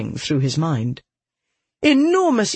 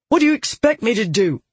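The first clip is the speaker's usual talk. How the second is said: quick, higher, irritated snap; snapping; 7 semitones higher, faster than usual. A narrator's reading. Soldier challenged by his companion, snapping. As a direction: snap it back fast. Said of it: "he snapped".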